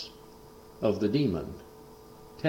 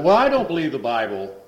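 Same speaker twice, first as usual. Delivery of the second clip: higher, louder defiant retort; retold scoff; 7 semitones higher, 7 dB louder than usual. Son scoffing at the preacher, higher and louder. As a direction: throw the Bible back at him.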